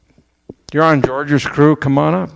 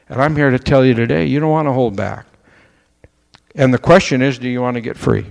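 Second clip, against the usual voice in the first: normal talking voice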